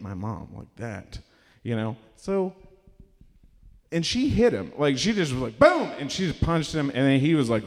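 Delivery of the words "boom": high loud boom; sound effect boom. Retold or imitated. Mom clocks her drunk son, loud boom. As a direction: punch the word out.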